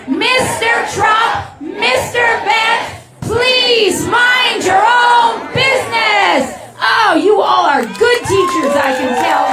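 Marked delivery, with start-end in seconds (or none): 0.1-6.6 stern teacher voice. Shown as a demonstration. Speaker leads crowd in a stern teacher's reprimand. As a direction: scold in drawn-out, high, ringing syllables. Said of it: "one more time for the people".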